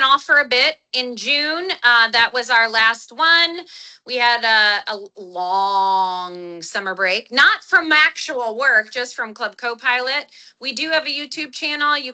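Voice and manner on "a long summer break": LONG held on a low, lingering vowel; drawn-out LONG. Real, not acted. Host telling viewers about the months off, LONG drawn out. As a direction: stretch LONG way out, low and slow.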